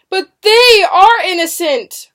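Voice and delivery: high, loud, drawn-out protest; impassioned declaration. A narrator's reading. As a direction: protest their innocence at full voice. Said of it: "William declared".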